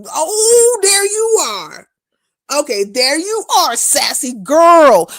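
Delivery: loud, high and drawn-out hello; excited greeting. Real, not acted. Greeting a viewer who finally showed up, loud and excited. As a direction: call out to her like you just spotted her.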